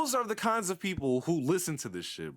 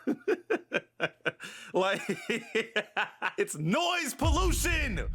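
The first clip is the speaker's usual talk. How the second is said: laughing; 5 semitones higher, slower than usual. Real, not acted. Cracking up mid-sentence.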